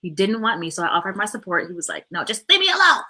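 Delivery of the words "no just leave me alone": high, loud mock yell; imitated tantrum outburst; upset child. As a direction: snap like a boy wanting to be left alone. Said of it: "having a really hard time".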